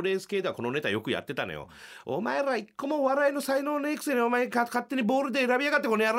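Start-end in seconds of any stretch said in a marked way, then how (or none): 2.0-6.2 high, loud mock fury at the judges; mock stage rant. Comic duo berating the judges onstage, loud mock rant. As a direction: bark it at the audience like a stage rant. Their angry stage routine acted out.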